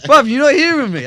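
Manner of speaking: retold, high and loud insistence. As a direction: raise your voice to get through. Insisting friend has not heard.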